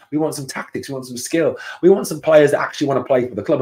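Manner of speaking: normal talking voice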